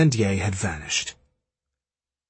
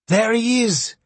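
high, urgent yell; yelled call; 11 semitones higher, 3 dB louder than usual. A narrator's reading. Woman at the window alerting soldiers to the fugitive, yelled. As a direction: cry out sharply, pitched way up. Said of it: "she yelled".

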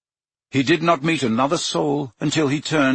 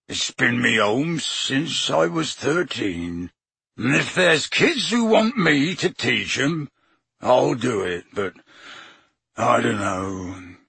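slow, choked-up giant's voice; a big man fighting back tears; tearful giant; slower than usual. A narrator's reading. Hagrid to the teachers, slow and tearful. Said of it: "fat tears still leaking out".

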